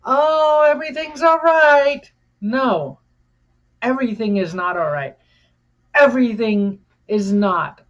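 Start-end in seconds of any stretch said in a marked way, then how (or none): 0.0-2.1 high, loud, sing-song fake cheer; a sarcastic, falsely bright voice, much higher and louder; mock cheerful pretending. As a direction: chirp it like someone pretending all is fine. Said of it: "pretend like".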